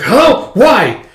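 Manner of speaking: high, loud cry of disbelief; dismayed outburst. Acted. Boy reacts to his new seatmate, loud dismay. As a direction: punch out each word in mock dismay.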